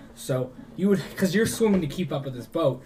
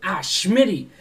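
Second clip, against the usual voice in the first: exasperated exclamation; 5 semitones higher, 6 dB louder than usual. Acted. Captain NPC exclaims at player.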